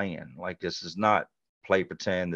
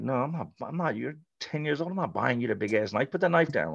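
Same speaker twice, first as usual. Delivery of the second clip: higher, softer mom voice telling him off; mom scolding; 6 semitones higher, 3 dB quieter than usual. Retold or imitated. Mom scolding her ten-year-old over a big knife, higher pitched. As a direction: mimic mom's scolding, pitched up. Mom refusing at yard sale.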